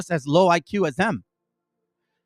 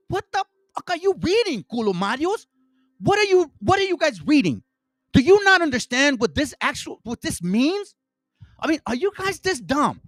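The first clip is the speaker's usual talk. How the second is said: exasperated outburst; 6 semitones higher than usual. Real, not acted. To viewers who misread the book, an exasperated high outburst. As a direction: blast them for misreading the book. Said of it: "I'm not getting mad".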